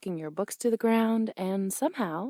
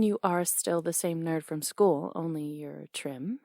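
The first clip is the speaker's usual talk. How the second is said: ordinary talk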